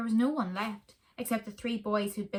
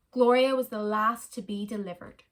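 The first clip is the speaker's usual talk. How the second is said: ordinary delivery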